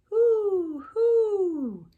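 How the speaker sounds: high loud hooting owl. Pretend. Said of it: "He can sound like an owl".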